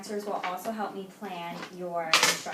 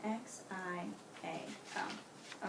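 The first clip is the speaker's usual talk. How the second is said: slow spelling; 10 dB quieter, much slower than usual. Real, not acted. Spelling the name back slowly.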